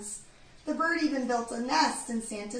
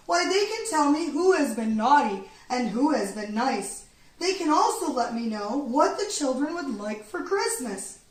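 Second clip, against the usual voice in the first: louder hearty Santa voice; jolly Santa; 7 dB louder than usual. A narrator's reading. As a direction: Santa excitedly laying out the plan. Santa plans happily.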